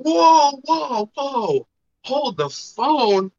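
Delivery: sudden shock, then slowing down; startled whoa. Retold or imitated. Pumpkin hits his leg.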